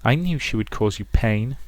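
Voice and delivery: quiet menace from a possessive mother. Acted. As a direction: croon it softly, drawing out key words.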